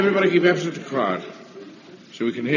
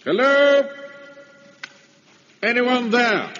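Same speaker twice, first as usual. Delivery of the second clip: calling out; 11 semitones higher, much slower than usual. Acted. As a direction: call out to an empty house. Calling into empty house.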